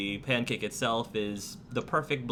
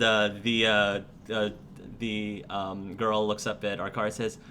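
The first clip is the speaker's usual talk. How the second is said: normal talking voice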